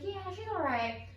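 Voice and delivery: put-on high, drawn-out kid's reply; imitated unimpressed daughter. Daughter answering friends about her cool mom, mock grudging. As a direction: stretch it high, mimicking a grudging kid.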